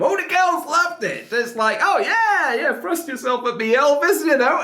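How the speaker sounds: high, giddy fangirl squeal; swooning fangirls. Retold or imitated. Reactor mimics girls swooning over [name], high giddy voice.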